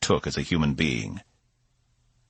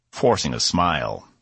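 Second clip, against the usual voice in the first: plain reading voice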